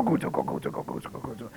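low, muttered "Gogudzo, gogudzo" fading to a breathy mumble; mock grumble. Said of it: "you can just go".

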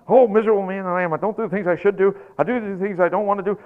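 loud, rushed litany of self-loathing; hammed-up wretched complaint, rattled off; mock miserable griping. Preacher mimicking people stuck in Romans seven, mock misery. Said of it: "they go around through life like this".

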